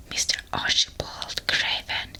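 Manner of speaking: ordinary delivery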